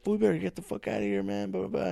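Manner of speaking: high, fast mock threat; tough threat. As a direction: brush him off with a hammed-up threat. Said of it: "And I was like".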